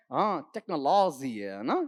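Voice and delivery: a hint of a smug student; mocking students. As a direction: imitate a know-it-all kid, slow and low. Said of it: "बोलते हो तुम लोग ऐसे".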